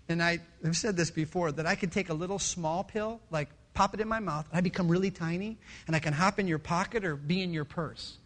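ordinary delivery

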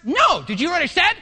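irritated cut-off. Retold or imitated. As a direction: shut them down mid-sentence. Boss shouting down employee.

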